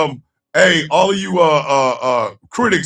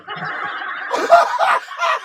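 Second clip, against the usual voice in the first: very high burst of laughter; 3 dB quieter than usual. Real, not acted. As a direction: let out a squealing laugh.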